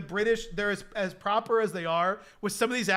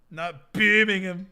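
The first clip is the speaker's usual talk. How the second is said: imitated British accent; 4 dB louder, slower than usual. Riffing on video catchphrase.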